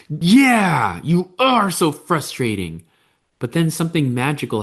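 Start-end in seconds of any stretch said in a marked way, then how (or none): 0.1-2.8 put-on higher, drawn-out child's complaint; frustrated kid. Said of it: "And then your kid goes".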